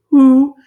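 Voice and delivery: high loud owl hoo. Shown as a demonstration. Teacher imitating an owl hoo for the class. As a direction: hoot it like an owl. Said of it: "sound an owl makes".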